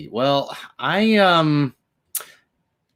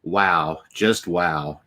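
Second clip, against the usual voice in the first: usual speaking voice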